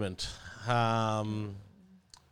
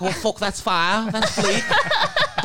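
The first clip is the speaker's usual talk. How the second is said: higher, slow mock slang, laughing at the end; imitating slang voice; 8 semitones higher, 4 dB louder, much slower than usual. Mocking how Kyle talks slang to the hosts, laughing. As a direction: ham up the slang lines. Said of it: "Doesn't make sense in this voice".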